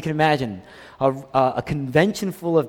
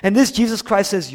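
ordinary delivery

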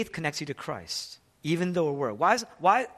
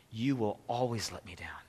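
whispered, soft and low; 5 semitones lower, 6 dB quieter than usual. Acted. Jesus warns disciples they will fail him, whispered. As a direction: breathe it as a quiet confession. Jesus's quote, hushed second point.

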